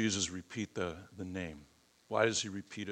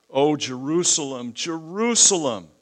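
higher, louder lament, rising on the second name; lamenting cry; 7 semitones higher, 12 dB louder than usual. Shown as a demonstration. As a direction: mourn over the city as you call it. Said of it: "it's a lamentation".

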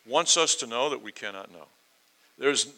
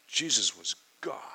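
whispered declaration. Real, not acted. Preacher to congregation on who Jesus is, whispered. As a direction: whisper it as the weightiest line. Sermon climax, confessing Christ.

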